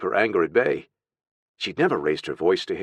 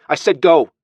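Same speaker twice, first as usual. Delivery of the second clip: loud, high command; stern, raised-voice dismissal; sharp order; 8 semitones higher, 9 dB louder than usual. Acted. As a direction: order the child away sharply. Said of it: "Her sharp tone".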